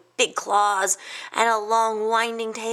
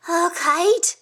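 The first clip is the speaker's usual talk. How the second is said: high, louder startled question; gasped question; 10 semitones higher, 5 dB louder than usual. A narrator's reading. Hedgehog hearing the kite plan, high startled gasp. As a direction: gasp the words in alarm. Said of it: "Quince gasped".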